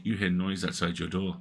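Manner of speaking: normal talking voice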